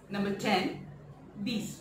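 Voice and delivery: "these" said as French dix, short and clear; French pronunciation. Shown as a demonstration. Teacher showing students how to say ten in French. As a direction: model the French word slowly for learners. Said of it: "when it comes to number ten, we call it these".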